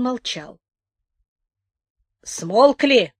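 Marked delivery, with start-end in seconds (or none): 2.2-3.1 gloating. A narrator's reading. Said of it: "злорадно спросил старик".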